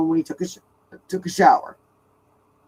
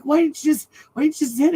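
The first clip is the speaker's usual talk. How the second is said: exasperated question; 9 semitones higher than usual. Retold or imitated. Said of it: "I'm like".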